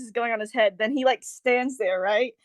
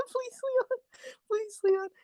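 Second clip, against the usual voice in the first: mock pleading; 9 semitones higher, 11 dB quieter, slower than usual. Player begging the character.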